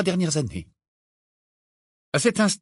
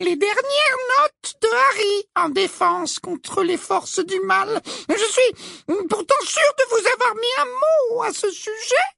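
very high slow sugary voice; falsely sweet schoolmistress; simpering. A narrator's reading.

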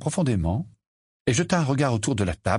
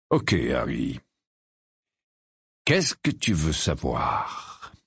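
calm godfather; 8 semitones lower, much slower than usual. A narrator's reading. Sirius starts answering Harry.

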